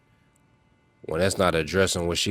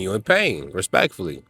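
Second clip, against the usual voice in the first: ordinary delivery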